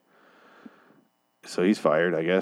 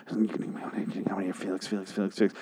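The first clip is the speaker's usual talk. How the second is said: incoherent muttering; 9 dB quieter than usual. Acted. Robot woman babbling the technician's name, mock muttering. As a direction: mumble it so only the name comes through. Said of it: "you're muttering incoherently".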